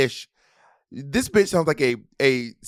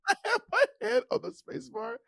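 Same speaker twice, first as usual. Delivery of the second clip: laughing while talking; 7 dB quieter than usual. Real, not acted. Still laughing at chat.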